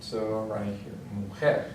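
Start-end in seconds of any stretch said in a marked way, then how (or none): 1.1-1.6 "mujer" stressed on its final syllable, jer; stress on last syllable. Shown as a demonstration. Teacher shows learners where Spanish stress falls, "mujer" stressed on jer. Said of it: "stress is going to be on the last syllable".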